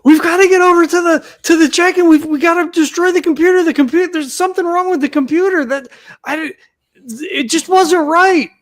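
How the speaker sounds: high, urgent in-character outburst; frantic yelling. Acted. Rescued character urging the others to destroy the computer, frantic yelling. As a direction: pitch it way up and tumble over the words. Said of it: "And he's just yelling".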